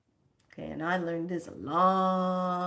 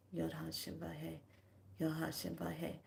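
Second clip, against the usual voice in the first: soft, inward murmur; quietly in your mind; 13 dB quieter than usual. Shown as a demonstration. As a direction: say it softly as if inside your head. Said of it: "saying it in your mind".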